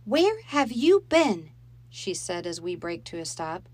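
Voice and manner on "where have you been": high, loud and slow, a mother's angry question; stern demand. Acted. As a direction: demand it slowly as a cross mother. Mom confronting late children.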